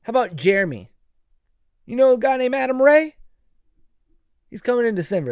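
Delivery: loud, high announcing; hype announcer. Real, not acted. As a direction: sell the guests like a host. Hyping guests.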